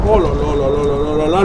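hammed-up dread sunk deep down; deep mock lament. Player spots the second Herobrine, groans in a deep put-on voice.

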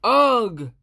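loud ugh; disgusted groan. Acted. Louis groaning at orange juice spilt.

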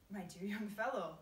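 usual speaking voice

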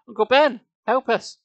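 high, loud plea; desperate shriek. A narrator's reading. Boy begging his uncle for help, shrieking desperately. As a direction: yell urgently for rescue. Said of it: "I shrieked desperately".

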